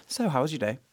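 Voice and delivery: softly spoken English accent. Shown as a demonstration. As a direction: drop quietly into an everyday English accent. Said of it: "I'll say things in English".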